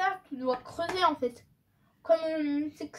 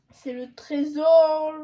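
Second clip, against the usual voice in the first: grand reveal; 11 dB louder, much slower than usual. Real, not acted. Unveils the treasure toy.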